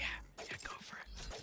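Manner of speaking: low, breathy whisper. Retold or imitated. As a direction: whisper it like a sly aside. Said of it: "He's like".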